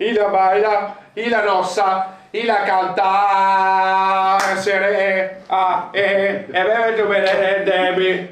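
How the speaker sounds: changed voice. Acted. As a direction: sing it in a put-on voice. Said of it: "Ma perché cambia voce?".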